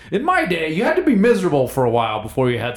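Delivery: hammed-up get-off-my-lawn grumble; a hint of a cranky grandpa lecturing the young; mock grumpy old-timer.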